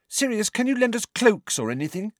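put-on high girl's voice, eager; Ginny. A narrator's reading. Ginny asking Sirius for cloaks, high and eager. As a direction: ask quickly in a high young voice.

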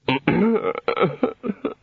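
high wordless whimper. A narrator's reading. Senior wizard overwhelmed by the housekeeper, whimpering. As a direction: let out a small broken whimper. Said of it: "whimpered the senior wrangler".

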